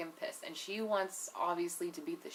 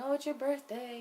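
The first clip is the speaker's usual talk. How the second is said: mock scream; 6 semitones higher than usual. Friends come home screaming for her birthday, mock scream. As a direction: mildly mimic excited screamers in a higher pitch. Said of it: "you know, screaming".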